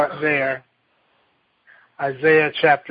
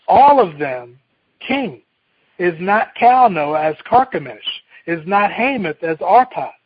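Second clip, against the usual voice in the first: scripture reading; 4 semitones higher, 3 dB louder than usual. Acted. Reads scripture aloud.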